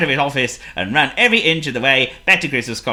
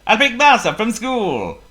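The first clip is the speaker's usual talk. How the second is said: cheerful Potto; 9 semitones higher, 4 dB louder than usual. Acted.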